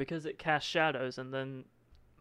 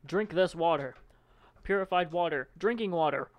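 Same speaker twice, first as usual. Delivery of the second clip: names getting louder, ending in a yell on water; building to a shout; 7 dB louder than usual. Acted. Mocking brand names, rising to a shout. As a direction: build it up, then bellow the last word.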